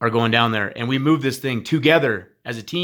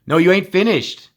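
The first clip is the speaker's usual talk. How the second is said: raised exhortation; 6 semitones higher, 4 dB louder than usual. Real, not acted. Exhorting students not to stop.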